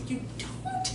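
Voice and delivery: very high, soft, rising mock surprise; surprised disbelief. People doubting she is undiagnosed, high mock surprise. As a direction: squeak out an imitated doubtful question.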